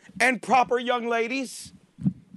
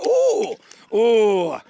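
breakfast moan. Shown as a demonstration. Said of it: "that's the sound of breakfast".

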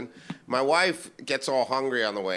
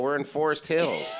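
usual speaking voice

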